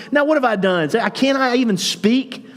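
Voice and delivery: put-on high, exasperated kid-brother whine; whiny protest. David protesting to his older brother, in a high exasperated whine. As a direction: complain like a wronged little brother.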